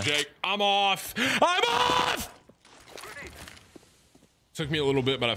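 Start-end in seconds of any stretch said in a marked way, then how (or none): 0.4-2.3 high yell jumping to a piercing shriek; escalating yell. Real, not acted. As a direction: cry it out, then shriek it far higher.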